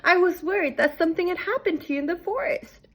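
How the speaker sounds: high, fretting granny voice; lightly voiced old woman; worried Grandma. A narrator's reading. Grandma tells her visitor she was worried, in a high granny voice. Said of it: "said Grandma".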